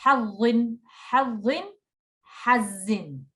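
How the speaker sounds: hazin with the jaw dropped open. Shown as a demonstration. Teacher modelling for student, jaw-dropped hazin. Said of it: "just drop your jaw".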